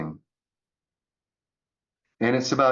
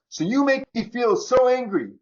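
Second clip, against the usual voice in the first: mock angry accusation; 12 semitones higher, 3 dB louder than usual. Acting out blaming others.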